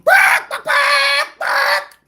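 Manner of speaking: very high, loud yapping barks; mock barking whine. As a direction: yap like a small dog. Mocking whining, barking.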